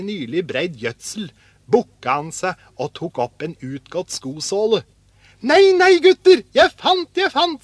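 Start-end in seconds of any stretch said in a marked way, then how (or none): 5.4-7.6 very high, loud excited cry; overjoyed shout. A narrator's reading. Askeladden hails the boys over another find, overjoyed. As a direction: shout as if it is treasure.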